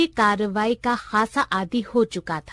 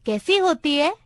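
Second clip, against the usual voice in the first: ordinary delivery, nothing marked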